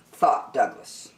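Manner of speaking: ordinary delivery, nothing marked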